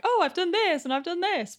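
high-pitched put-on boast; mock bragging. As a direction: send up someone celebrating themselves. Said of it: "I find it really awkward being like".

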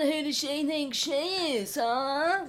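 high, drawn-out sneering imitation of resentment; mock furious bloke. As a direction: play an indignant bloke, slow and high. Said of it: "silent army of furious men".

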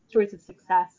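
usual speaking voice

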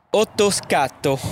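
slow Italian accent, drawn out. Shown as a demonstration. Game host posing the next word, slow Italian accent. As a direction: draw the word out in your best Italian. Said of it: "mon meilleur accent italien".

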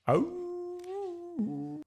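long, very high howl; dog howl. A narrator's reading. Whiffer drowning out Nick's carol, howling. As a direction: howl on one soaring note. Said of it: "Whiffer howled louder still".

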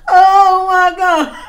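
loud laughing exclamation. Real, not acted. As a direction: shriek it through laughter. Laughing fit.

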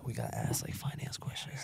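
unvoiced whisper into the mic. Real, not acted. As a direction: whisper it like plotting. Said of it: "literally whispering into the mic".

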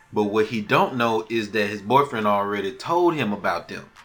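ordinary talk

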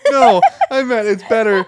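loud, high protest; loud insistence. Real, not acted.

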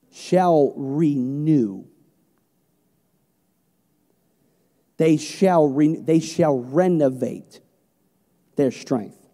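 slow, weighty preaching of the verse; scripture reading. Acted. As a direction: draw every phrase out with power. Reads scripture with force.

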